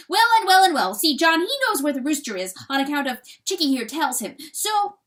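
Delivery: high, loud, hurried patter; blustering weasel. A narrator's reading. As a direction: rattle out a defence at high pitch. Weasel blusters defensively to the hen.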